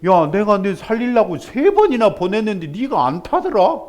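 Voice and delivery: loud, high, exasperated reply; God answers the complaining man, loud and exasperated; God's retort. Acted. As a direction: scold him with a knowing jab. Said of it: "하나님이 뭐라 그러세요?".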